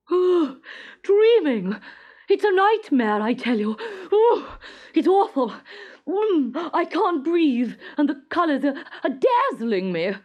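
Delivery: high, loud, hammed-up wailing; a smothered king lamenting loudly; distressed. A narrator's reading. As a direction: complain in fake horror.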